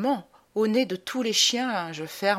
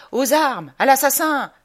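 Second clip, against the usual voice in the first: loud alarmed cry, higher; shouting; 5 semitones higher, 7 dB louder than usual. Acted. A woman in the mob raising the alarm, loud shout. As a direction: bellow it like a panicked bystander.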